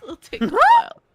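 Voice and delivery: high squeaky voice. Real, not acted. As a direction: squeak it out jokingly. Sudden squeaky high burst.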